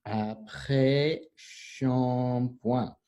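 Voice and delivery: "Après shampoing" very slow, each syllable held apart. Shown as a demonstration. Teacher breaks down "Après shampoing" for class, drawn-out syllables.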